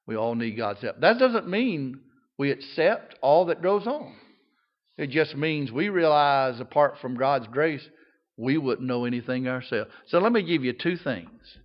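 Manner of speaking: usual speaking voice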